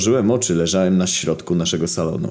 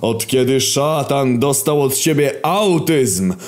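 bellowing villain monologue; very slow, high, drawn-out megalomaniac roar; Warol Kojtyła; 12 semitones higher, much slower than usual. Acted. Villain's roaring monologue as hell's ruler.

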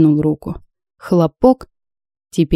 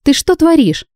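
outraged shout; 9 semitones higher than usual. Acted. Tricked on the cliff by mage.

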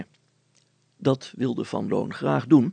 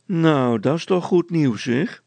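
high, loud mock delight; 6 semitones higher, 7 dB louder than usual. A narrator's reading. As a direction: feign being impressed, mockingly. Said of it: "antwoordde de veldwachter spottend".

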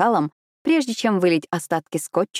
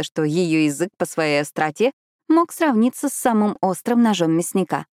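ordinary delivery, nothing marked

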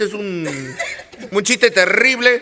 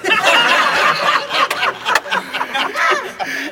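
high real laugh; 12 semitones higher than usual. Real, not acted. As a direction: crack up at your own joke. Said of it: "Este es terrible". (carcajada aguda y real)

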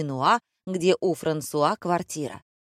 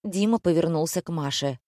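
plain reading voice

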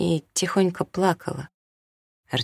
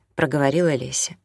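plain reading voice

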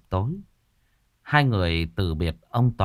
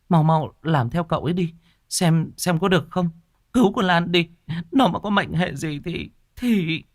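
much higher, desperate mother begging; tearful pleading; 8 semitones higher than usual. Acted. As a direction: beg through rising tears, voice climbing. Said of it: "đoạn khóc lóc". (người mẹ khóc lóc van xin, giọng cao vút)

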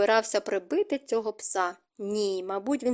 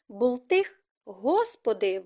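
ordinary delivery, nothing marked

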